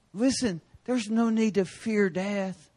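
ordinary talk

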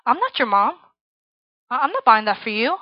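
lightly voiced tough mom; a touch of attitude, imitating a blunt mother; sassy gangster mom. Mom refusing her daughter's friend at the bookstore, mildly sassy. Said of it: "she's a little gangster".

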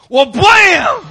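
loud, high shouted kick sound; shouted blam. Retold or imitated. Pastor acting out David's kick, shouted blam. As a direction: blast it like a door kicked in.

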